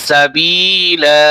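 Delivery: "সাবিলা" drawn out slowly with a held final লা; stretched ending. Shown as a demonstration. Teacher showing how to pause, "সাবিলা" with a lengthened ending. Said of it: "এখানে টান হবে".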